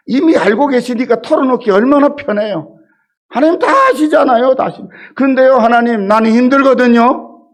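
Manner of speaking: impassioned sermon climax; preaching at full force. Acted. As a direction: press the congregation with fervor.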